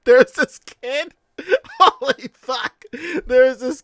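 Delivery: can barely get it out for laughing; laughing hard. Real, not acted. Cracking up telling story.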